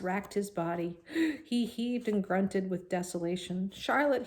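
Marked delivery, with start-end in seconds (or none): 3.7-4.3 high, aching moan of her name. A narrator's reading. Wilbur grieving for dying Charlotte, high moan. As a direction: call her name in grief. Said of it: "he moaned".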